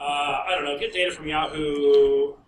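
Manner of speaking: usual speaking voice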